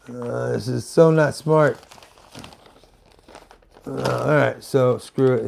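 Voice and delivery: ordinary delivery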